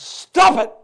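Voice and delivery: high, loud retold scream. Boy retelling how he stopped his father: a high, loud scream. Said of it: "I screamed to the top of my lungs".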